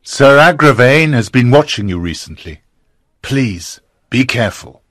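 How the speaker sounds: low, earnest caution; worried friend's low warning. A narrator's reading. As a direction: plead with a friend, softening at the end. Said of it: "Sir Bors warned".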